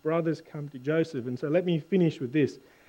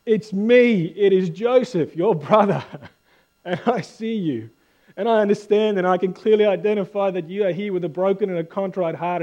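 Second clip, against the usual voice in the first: impassioned reveal; 4 semitones higher, 7 dB louder than usual. Acted. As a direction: proclaim it as Joseph revealing himself to his brothers. Joseph tearfully revealing himself to brothers.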